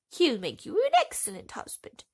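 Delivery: loud, high scolding threat; threatening field mouse. A narrator's reading. The field mouse threatens to bite Thumbelina, loud and scolding. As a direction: snap a threat at her.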